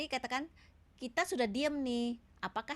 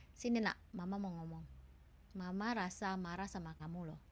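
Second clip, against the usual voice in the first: calm but firm; 2 semitones lower, 5 dB quieter than usual. Shown as a demonstration. Mother calmly tells her child she is angry, firm and even. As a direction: state it steadily like a composed parent. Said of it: "marahnya elegan tapi tegas".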